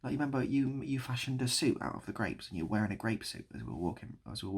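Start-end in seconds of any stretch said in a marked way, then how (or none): none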